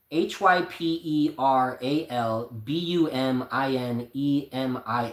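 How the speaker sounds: slow letter-by-letter spelling of H Y P E R A L B U M I N E M I A; spelled out. Shown as a demonstration. Telling viewers the word by spelling it slowly. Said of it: "I couldn't really pronounce what he had".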